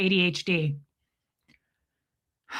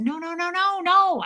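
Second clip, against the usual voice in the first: emphatic refusal; 9 semitones higher than usual. Retold or imitated. Patient refusing non-stimulant pills, high-pitched protest. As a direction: cut them off with high-pitched nos.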